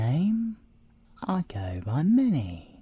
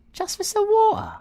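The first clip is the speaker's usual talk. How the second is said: much higher and louder, squeaky disbelief; mock indignation; 8 dB louder than usual. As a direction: squeal it in pretend outrage. Mock outrage at the inspector.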